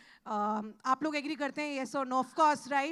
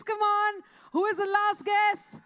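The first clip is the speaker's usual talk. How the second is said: raised, slow call to the crowd; crowd-hyping shout; 7 semitones higher, 3 dB louder, slower than usual. Acted. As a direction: pump up the audience, stretch it out. Host hyping crowd for guest. (भीड़ को जोश दिलाती पुकार)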